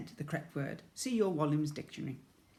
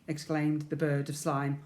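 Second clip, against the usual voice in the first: usual speaking voice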